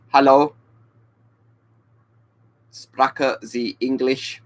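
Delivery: slow, deliberate amateur German accent; careful beginner's German accent; put-on German accent. Shown as a demonstration. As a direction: try out German slowly, putting on the accent. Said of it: "you kind of want to do an accent".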